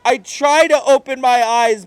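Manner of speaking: ordinary delivery, nothing marked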